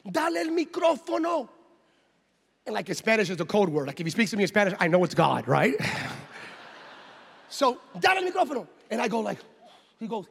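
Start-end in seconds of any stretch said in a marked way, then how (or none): 0.1-1.5 mock-yelled order, pitched high; yelling in Spanish. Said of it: "he yells in Spanish now". 8.0-8.7 mock-yelled order, pitched high; yelling in Spanish. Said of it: "he yells in Spanish now".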